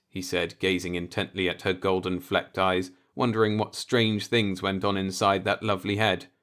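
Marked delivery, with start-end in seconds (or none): none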